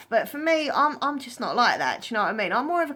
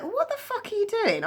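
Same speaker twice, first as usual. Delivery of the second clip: high-pitched, appalled reenactment; incredulous high-pitched retort; appalled disbelief; 13 semitones higher than usual. Retold or imitated. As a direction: relive the disbelief, pitch shooting up. Recounting her shocked reaction to spit.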